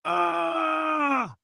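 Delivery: high piercing scream into nothing; void scream. Pretend. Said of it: "Let's do Scream into the Void Unhinged".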